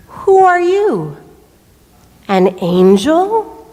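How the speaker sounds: very slow, drawn-out questions with wide pitch swings; slow, acted wonder at the rescuer; wondering old man. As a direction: voice a dazed old man waking up. Revived old man asks boy.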